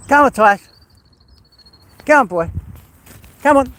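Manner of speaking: loud, high-pitched call to a dog. Real, not acted. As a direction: call out sharply to your dog. Calling dog on a walk.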